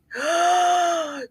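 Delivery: a sudden high gasp of shock; sharp shocked gasp. Real, not acted. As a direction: gasp as if the bad news just hit. Hears friend lost house.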